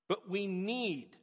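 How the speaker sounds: "need" held slow and stressed at the end; slow down and lean on "need". Shown as a demonstration. Pastor stressing to his flock that they need community, emphatic. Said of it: "I emphasize the word need".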